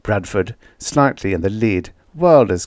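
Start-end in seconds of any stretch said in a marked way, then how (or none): none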